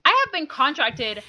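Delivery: hammed-up entitled rant; mock berating. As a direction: put the staff in their place. Acting out berating store staff.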